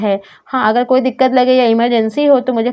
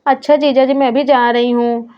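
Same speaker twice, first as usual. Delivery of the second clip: ordinary talk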